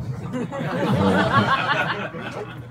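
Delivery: soft breathy laugh; a quiet airy chuckle. Real, not acted. Laughs at own story.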